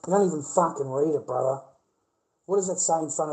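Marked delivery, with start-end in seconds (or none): none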